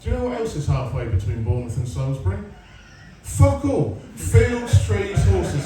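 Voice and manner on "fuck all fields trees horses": punchy. Real, not acted. Punchline listing nothing.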